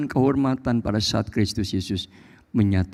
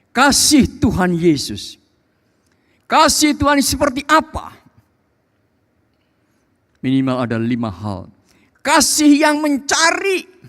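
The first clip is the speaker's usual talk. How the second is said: very high, ringing proclamation; impassioned, raised pulpit voice; full-force preaching; 7 dB louder than usual. Real, not acted. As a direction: proclaim it fervently to the congregation.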